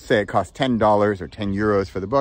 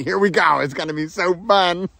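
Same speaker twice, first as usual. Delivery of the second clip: mock excitement; 5 semitones higher than usual. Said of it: "I'm gonna get really crazy boring".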